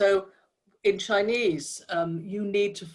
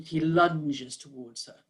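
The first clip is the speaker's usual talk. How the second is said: long word; 2 semitones lower, slower than usual. Shown as a demonstration. Coach showing word choice to describers, "lunges" drawn out. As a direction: draw out "lunges" slowly. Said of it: "a long word".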